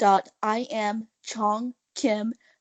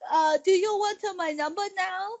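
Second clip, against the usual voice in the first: higher, halting caller; a mock flustered caller; hesitant caller voice; 6 semitones higher than usual. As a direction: stumble through the number nervously. Retold caller, high.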